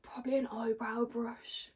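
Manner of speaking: slow whispered aside. Real, not acted. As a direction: breathe it like a secret. Whispered answer, then laughs.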